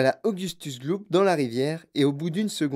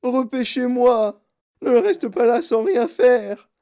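high, loud, stretched-out demand; shrieked plea, high and drawn out; frantic shout; 9 semitones higher, 8 dB louder, slower than usual. A narrator's reading. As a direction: cry out slowly and desperately for action. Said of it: "cria madame Gloop".

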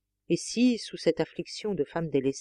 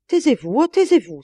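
higher, much louder cry; frightened outcry; 5 semitones higher, 9 dB louder than usual. A narrator's reading. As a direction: shout her down in alarm. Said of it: "s'écria-t-elle".

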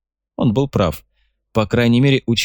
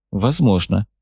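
ordinary delivery, nothing marked

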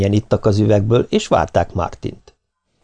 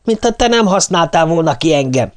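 high-pitched, heated tirade; angry yelling; 8 semitones higher than usual. A narrator's reading. Martin hurls accusations back at Nancy, high furious yelling. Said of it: "ordította Martin".

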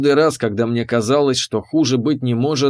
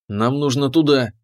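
usual speaking voice

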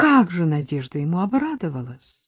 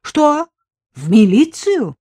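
higher, louder startled repeat; shocked; 8 semitones higher, 6 dB louder, slower than usual. Acted. Husband hears his wife was arrested, shocked outburst. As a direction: react as if the floor dropped away.

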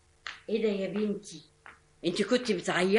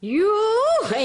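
startled exclamation; 13 semitones higher, 4 dB louder, much slower than usual. Acted. Aunt reacts to insult.

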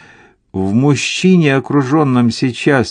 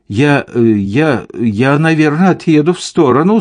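higher, hesitant start, then rushed; flustered stammer; 5 semitones higher, 4 dB louder than usual. A narrator's reading. Husband deciding to move the car from riders, flustered stammer. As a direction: stumble over the first word, then hurry it out.

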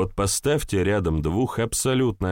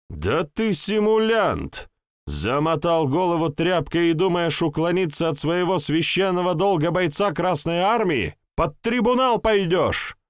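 hysterical yelling; 10 semitones higher than usual. A narrator's reading. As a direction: scream the threats hysterically. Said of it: "истерично заорал лейтенант".

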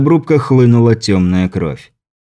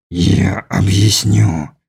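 low, slow inhuman voice; slow and threatening, from deep down; menacing alien; 3 semitones lower, much slower than usual. A narrator's reading. (низкий зловещий голос чужака)

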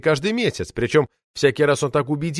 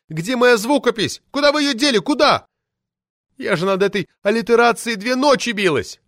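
put-on high, loud indignant shriek of an old party lady; shrill furious official lady yelling; roaring outrage; 9 semitones higher, 4 dB louder than usual. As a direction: howl it out like an enraged sabre-toothed tigress. Poetess scolds editor.

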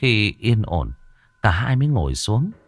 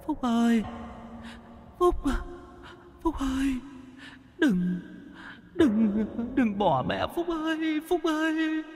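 ghostly wailing mother; 8 dB quieter than usual. Acted. Dead mother's ghost wailing after her fleeing son, high and soft. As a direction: call his name like a lost spirit in a dream.

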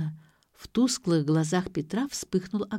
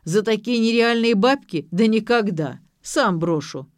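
loud, outraged refusal; blustering, raised-voice outrage; indignant; 4 semitones higher, 7 dB louder than usual. A narrator's reading. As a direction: protest loudly in an acted father's voice. Father indignant at the price.

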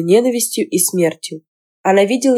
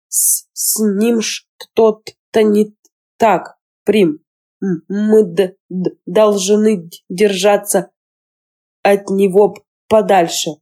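slow, halting speech, catching on the first sounds; scared boy stammering to Prim; stuttering; slower than usual. Acted. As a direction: stammer out a frightened warning. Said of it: "он начал заикаться".

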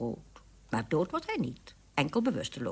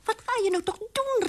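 high alarmed squeak; a frightened widow mouse calls out; squeaky startled call; frightened cry. Acted. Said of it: "riep de weduwe Muis verschrikt".

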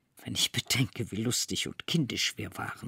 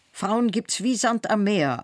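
raised, high-pitched dismissal; higher-voiced derisive outburst; scornful exclaiming; 9 semitones higher, 4 dB louder than usual. A narrator's reading. Scornful man argues on.

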